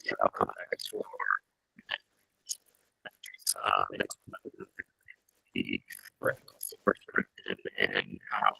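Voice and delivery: muffled, cutting-out call audio; underwater. Real, not acted. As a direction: voice swallowed by noise suppression. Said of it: "it just sounds like you're underwater".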